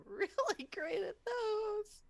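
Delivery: laughing through the words. Real, not acted. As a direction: giggle while talking. Player laughs at own bad luck.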